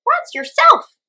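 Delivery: very high, loud chirpy hummingbird; high-pitched voice. A narrator's reading. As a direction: squeak it like a tiny cheeky bird. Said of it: "answered a high-pitched voice".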